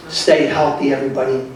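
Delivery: ordinary delivery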